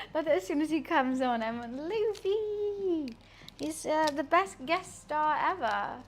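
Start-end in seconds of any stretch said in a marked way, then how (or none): 1.7-3.2 imitated pet call. Imitates own greeting to pet.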